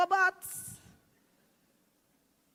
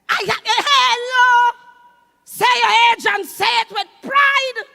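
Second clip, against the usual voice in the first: loud, much louder rallying call; loud proud shout; 5 semitones higher, 10 dB louder than usual. Real, not acted. Comedian urging the audience on age, loud proud shout.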